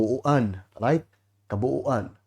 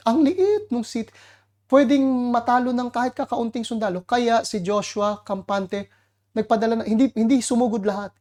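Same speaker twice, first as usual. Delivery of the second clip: exaggerated smallness; 8 semitones higher than usual. Mocking how tiny the city is.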